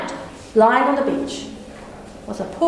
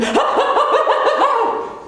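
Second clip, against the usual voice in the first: rabbit cackle; 9 semitones higher than usual. Acted. Rabbit sounds next to him.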